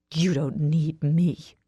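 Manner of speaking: slow, mild growl of rebuff. A narrator's reading. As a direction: growl each word at him slowly. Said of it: "I growl".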